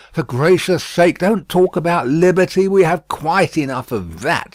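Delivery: busy housekeeper in a hurry; brisk Miss Pross. A narrator's reading.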